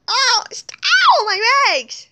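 high, loud yelp of pain; pained cry. Pretend. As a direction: cry out over broken legs in play. Pretend pain in broken legs.

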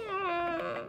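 ordinary delivery

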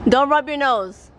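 normal talking voice

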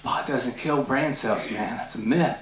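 lightly voiced lazy stoner, low and slow. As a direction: drawl it like a dazed stoner. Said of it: "friends that say".

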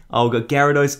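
ordinary talk

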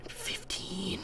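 a breathy whispered number; mock whisper. Host whispering the episode number to himself. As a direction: whisper it like a secret. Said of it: "I'm like".

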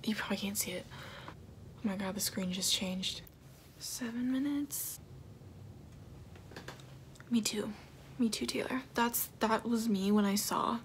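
ordinary talk

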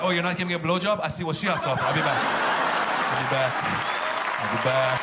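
walk out with a threat; mock threat. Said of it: "We're like".

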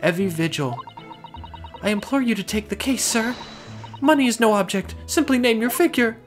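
high-pitched refined lady. Acted. Voices the client Evie.

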